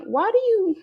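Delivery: squeaky high mock question. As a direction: pitch way up, mocking them. Mocking people who question her.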